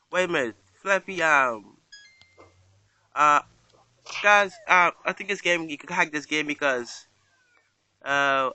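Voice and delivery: ordinary delivery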